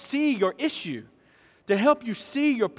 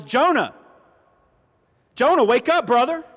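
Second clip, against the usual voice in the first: loud, raised call to wake someone; urgent rousing call; 4 semitones higher, 7 dB louder than usual. Retold or imitated. God breaks it down for Jonah, loud rousing call. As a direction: call out sharply to rouse him.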